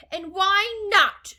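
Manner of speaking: high, loud, stretched demand; screamed. A narrator's reading. Mother demands Wonka's reason, screamed. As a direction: scream the challenge back. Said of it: "screamed Missus Gloop".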